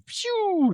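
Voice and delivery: high, soft zooming whistle; imitated whoosh. Podcaster showing how critters leave fast, imitated whoosh. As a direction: whoosh high and light, like something speeding off.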